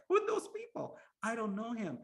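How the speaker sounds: high, loud bewildered cry; shocked. Acted. King stepping off the island, shocked by strangers. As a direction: sound utterly baffled at the crowd. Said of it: "And he's shocked".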